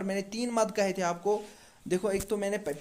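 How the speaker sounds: ordinary talk, nothing marked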